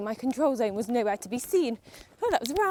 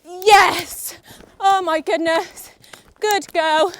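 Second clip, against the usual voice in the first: loud, high cheer easing into praise; elated relief; 6 semitones higher, 8 dB louder, much slower than usual. Real, not acted. Rider to horse after clearing the jump, loud elated relief. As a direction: cry out in triumph, then praise.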